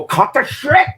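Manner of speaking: sudden high snapping command; mock angry owner snapping "Cut the shit!" at the dog; high-pitched imitated reprimand; angry bark at the dog. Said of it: "they're gonna go".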